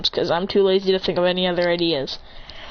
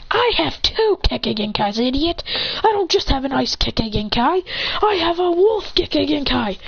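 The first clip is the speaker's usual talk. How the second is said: raised pitch, climbing at the end; acted anime fighter's taunt; defiant boast; 6 semitones higher than usual. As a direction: boast angrily at your opponent. Fighter boasts of hidden power.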